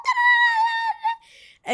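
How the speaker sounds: loud, very high chanted celebration; party cheer. Real, not acted. Hyping up friends before a shot, loud high chant.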